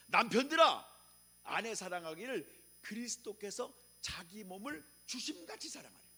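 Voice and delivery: loud proclaimed opening, then fading; scripture quote. Acted. Preacher proclaiming Ephesians to the congregation, loud then fading. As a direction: proclaim the verse.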